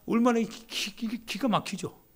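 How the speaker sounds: a breathless, amazed stammer; stunned gasp. Acted. (헉 하고 놀라 더듬는)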